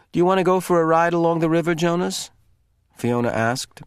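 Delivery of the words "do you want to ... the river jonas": nervous. A narrator's reading. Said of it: "biting her lip with nervousness".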